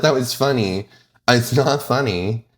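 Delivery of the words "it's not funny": ordinary talk, nothing marked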